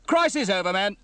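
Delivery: high, loud command voice; officer's announcement. Acted. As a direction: announce briskly to the whole crew. Officer announces to his crew.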